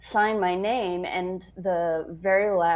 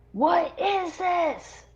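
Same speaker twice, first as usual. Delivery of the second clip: mock officer; 11 semitones higher, much slower than usual. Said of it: "And I'm like".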